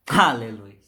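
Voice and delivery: low, loud shout of praise; fervent aleluia. Real, not acted. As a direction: cry it out to the church. Preacher exclaims praise. (aleluia fervoroso)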